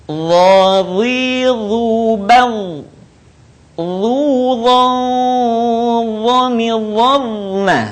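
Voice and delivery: Dha, Zi, Zu chanted on long sustained notes; held thick Dha. Shown as a demonstration. Teacher models practice, sustained thick chanting of Dha, Zi, Zu. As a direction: thicken each letter and hold the sound. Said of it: "Suara ditahan".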